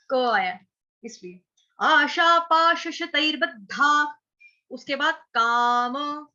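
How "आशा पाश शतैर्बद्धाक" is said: slow recitation. Shown as a demonstration. Teacher reciting the shloka slowly for students. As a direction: chant आशा पाश शतैर्बद्धाक slowly, syllable by syllable. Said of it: "विसर्ग का उच्चारण हो रहा है ख से".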